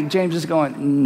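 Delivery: usual speaking voice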